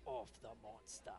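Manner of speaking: put-on low, soft, slow Boston accent. Partner retries the call under his breath, soft Boston accent. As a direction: murmur it slowly in a Boston accent. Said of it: "did it in the Boston".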